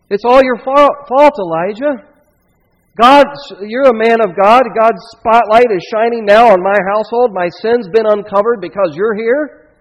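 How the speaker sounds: high, loud anguished accusation; distraught mother crying out at the prophet; accusing cry. Acted. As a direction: hurl the blame at him in grief. Grieving widow accuses Elijah.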